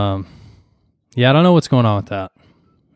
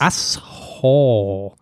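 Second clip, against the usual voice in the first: slow, stretched insult; drawn-out; much slower than usual. Acted. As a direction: drag the word out long. Drawn-out joke word.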